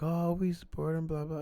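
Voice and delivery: high, singsong mimicry of fake support; put-on supportive voice, dismissive; mocking quote. Mockingly voices the family's claimed support.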